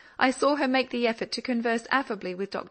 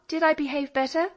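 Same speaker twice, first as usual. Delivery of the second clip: put-on eager young girl; 7 semitones higher, 4 dB louder than usual. A narrator's reading. Polly asking her father.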